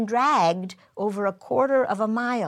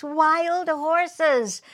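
excited; 7 semitones higher than usual. Retold or imitated. Child longing for wild horses.

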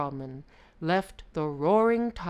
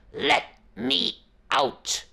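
demanding shout; 3 semitones higher, 8 dB louder, slower than usual. Acted. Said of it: "demanded the tiger".

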